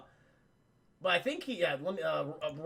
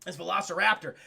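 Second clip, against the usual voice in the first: usual speaking voice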